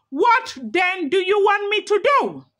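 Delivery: a high, sharp scream of frustration; lightly yelled, exasperated. A narrator's reading. The great lion screams at the begging elders, exasperated. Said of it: "He screamed at them".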